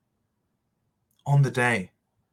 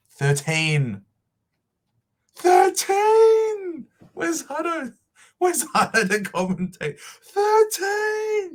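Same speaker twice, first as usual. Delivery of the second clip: cracked, very high cry of thirteen; anguished cry; 4 dB louder, slower than usual. Real, not acted. Fan crying out over thirteen straight losses, overwhelmed. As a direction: wail it like years of pain. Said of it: "Yes, thirteen".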